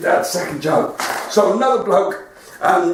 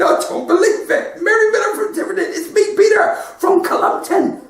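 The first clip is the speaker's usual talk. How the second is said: much higher, fast, delighted recognition; excited; 11 semitones higher than usual. Retold or imitated. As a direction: squeal with delight at an old friend. Peter amazed to meet her.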